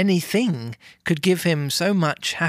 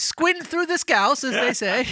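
high-pitched mock Liverpool accent; exaggerated Scouse; 9 semitones higher than usual. Reader jokes about squinting at the book, mock Scouse. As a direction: keep the silly Scouse voice going. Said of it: "Josh is so scouse right now".

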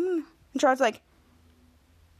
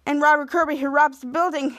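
ordinary delivery, nothing marked

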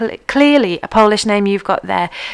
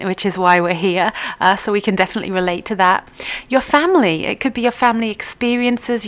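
ordinary talk, nothing marked

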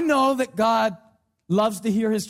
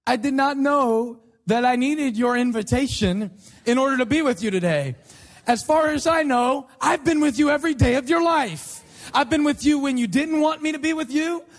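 sarcastic God. Acted. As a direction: feign being grateful for a needless invitation. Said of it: "isn't as sarcastic as I am".

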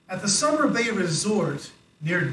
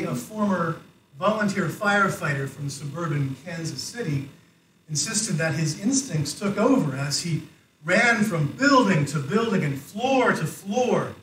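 normal talking voice

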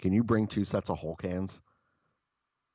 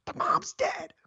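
sharp breathy whisper-yell; slower than usual. Retold or imitated. Whisper-yelling across the room at the boss. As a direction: hiss it urgently from the back of the room. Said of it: "am, like, whisper yelling".

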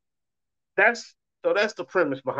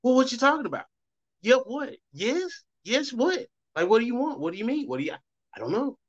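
baffled; 8 semitones higher than usual. Retold or imitated. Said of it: "Other people, it's like".

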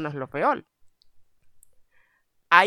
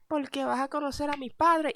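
high, sweet girlfriend voice, lightly put on; girlfriend's voice; 9 semitones higher, 5 dB quieter than usual. Girlfriend telling boyfriend he'll meet her parents, high and sweet. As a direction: chirp it like an excited girlfriend. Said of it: "Y me dijo".

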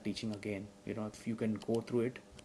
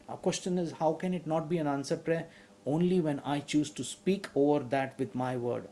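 higher, louder preaching building in force; fiery preaching; 5 semitones higher, 6 dB louder than usual. Acted. Pastor drives home God's word to the congregation, fiery and loud. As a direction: preach it with full conviction.

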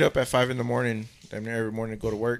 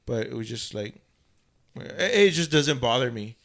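ordinary talk

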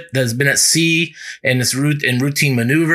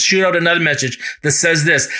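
ordinary delivery

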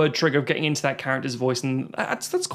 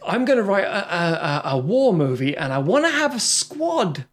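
mock screenwriter pitch; 3 semitones higher than usual. Host mocks a writer planning war movie, mock-eager.

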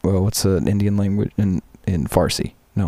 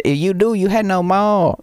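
thick mock Chinese accent, pitched high; 10 semitones higher than usual. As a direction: imitate a coworker's Chinese accent in a raised voice. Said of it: "Chinese accent".

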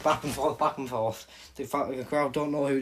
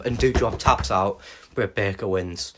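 ordinary delivery

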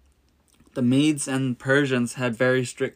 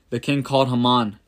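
usual speaking voice

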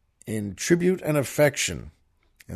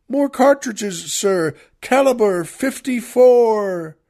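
put-on much higher, louder boy's shout; shrill child's cry; 11 semitones higher, 7 dB louder, slower than usual. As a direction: cry it out like a drummer boy calling back. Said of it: "with his shrill child's cry shouted".

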